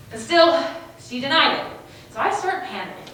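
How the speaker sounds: ordinary talk, nothing marked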